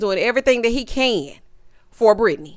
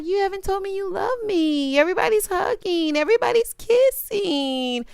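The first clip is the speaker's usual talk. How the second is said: imitating a lovelorn complaint, pitched way up; mock complaining; 11 semitones higher than usual. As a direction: plead it in a hammed-up high voice. Mimicking Chelsea complaining to boyfriend.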